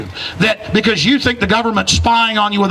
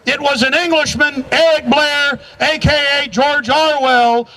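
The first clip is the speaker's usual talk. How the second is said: usual speaking voice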